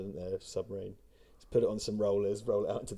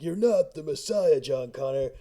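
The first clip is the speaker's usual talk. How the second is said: slow, higher sneering movie voice; mock movie line; 3 semitones higher, 5 dB louder, slower than usual. Quoting the film to mock it, in a slow sneering voice. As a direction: sneer it like a mocking film villain.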